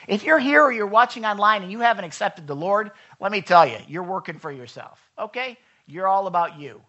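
normal talking voice

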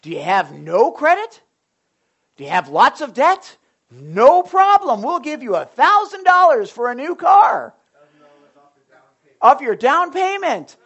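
car commercial pitch. Retold or imitated. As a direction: sell it like a pushy radio car ad. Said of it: "it was a car commercial, and it said".

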